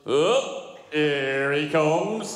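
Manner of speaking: loud, drawn-out deadpan wife; mock-weary wife greeting an advance; imitating wife. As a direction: stretch every word out, unimpressed and sarcastic. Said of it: "That was a bang-on impression".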